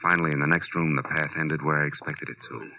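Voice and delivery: low, steady private-eye narration over music; hard-boiled narration. Acted. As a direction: tell it like a weary detective looking back.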